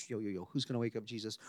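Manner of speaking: a jittery disciple whispering who will wake Jesus; nervous disciple. Retold or imitated. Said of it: "Someone's like".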